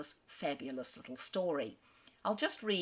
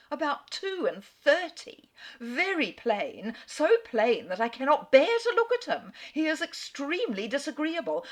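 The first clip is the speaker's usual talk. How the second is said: put-on louder, higher, dripping contempt for the old suitor; scornful disgust; 6 semitones higher, 8 dB louder than usual. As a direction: sneer at him, rising into indignation. Letter writer mocks her suitor.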